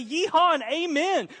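high, loud Texan whoop; hammed-up Texan yeehaw; Texas preacher. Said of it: "In Texas, a preacher would say".

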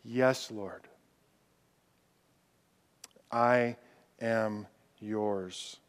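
whisper-close, like a private vow; hushed surrender. Pretend. Preacher voicing a worshipper's prayer, softly. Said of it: "You're doing it to say".

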